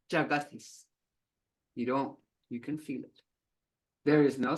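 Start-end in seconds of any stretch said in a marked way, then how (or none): none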